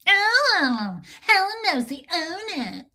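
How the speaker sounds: high, affected Bridesmaids voice; mock-impressed and high; Kristen Wiig impression. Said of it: "She did it again. She Kristen Wigged me again".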